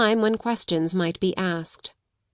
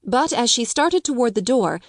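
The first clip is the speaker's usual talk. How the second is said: usual speaking voice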